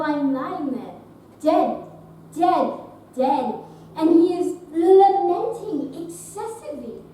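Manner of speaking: loud, punched-out theatrical complaint; exasperated ranting. Acted. Actress complaining about her Romeo, exasperated rant. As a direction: build the tirade in loud bursts. Actress mocks a hammy co-star.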